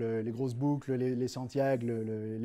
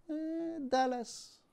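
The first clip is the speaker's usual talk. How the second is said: high, slow hesitant voice; small man squeezed between Texans, timid; timid little man; 11 semitones higher, 3 dB quieter, much slower than usual. Acted. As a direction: answer shyly, stalling.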